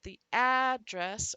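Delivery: first "address" punched on the first syllable, second "address" on the last; stress contrast. Shown as a demonstration. Teacher contrasts noun and verb, moving the stress in "address". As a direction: swing the punch from front to back across "address or the address". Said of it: "that they're stressing that syllable".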